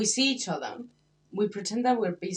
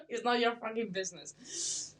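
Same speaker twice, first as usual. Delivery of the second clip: laughing sarcasm; 7 semitones higher, 6 dB louder, slower than usual. Acted. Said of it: "I'm polite".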